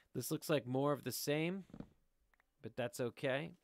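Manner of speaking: usual speaking voice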